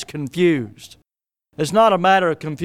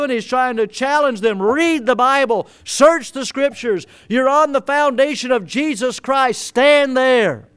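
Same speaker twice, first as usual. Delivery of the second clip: higher, rising fervent preaching; 6 semitones higher than usual. Acted. Pastor urging the congregation to stand firm, fervent. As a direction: build the fire toward the climax.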